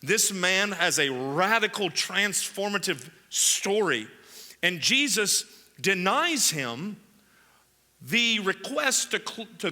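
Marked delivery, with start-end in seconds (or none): none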